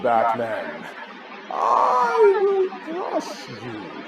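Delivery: dramatic movie villain voice, leaping high on drawn-out words; slow, drawn-out theatrical menace with swooping pitch; Bane. Shown as a demonstration. Speaker shows a class a Batman character, dramatic slow villain voice. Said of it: "guess what character this is from Batman. I'm gonna get really dramatic".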